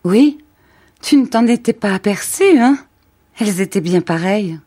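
proud, joyful and naive. Acted. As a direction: boast like a child showing off. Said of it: "souriait d'une joie orgueilleuse et naïve".

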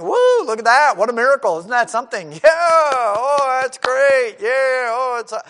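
loud, high, hammed-up wonder with a laugh; mock gawking crowd. Preacher lampooning the crowd, loud mock wonder. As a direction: play the dazzled crowd oohing at a miracle.

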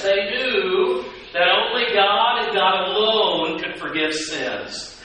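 ordinary talk, nothing marked

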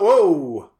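loud surprised whoa. Real, not acted. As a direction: react with wide-eyed surprise. Sees screen.

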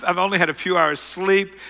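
ordinary talk

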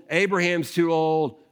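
ordinary talk

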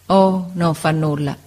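slow, gentle and wistful reassurance; kind, wistful smile. Acted. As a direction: smile sadly and let him off kindly. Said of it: "con un sorriso buono e malinconico". (lento, con un sorriso buono e malinconico)

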